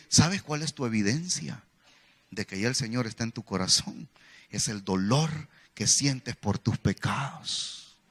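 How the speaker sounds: low, softened, slowing to the end; tenderly reassure, in a low voice; gentle solemn counsel. Retold or imitated. Preacher answering a weeping student, gently solemn.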